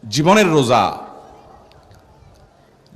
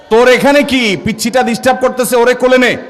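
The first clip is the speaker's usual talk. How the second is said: loud, higher, irritated shooing; scolding grandparent; 6 semitones higher, 3 dB louder than usual. Acted. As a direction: chase the pest away from the table. Grandparents scold the boy who broke fast.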